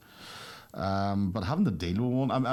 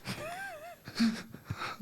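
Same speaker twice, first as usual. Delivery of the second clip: high-pitched burst of laughter; 7 dB quieter than usual. Real, not acted. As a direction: cackle up high. Laughs after punchline.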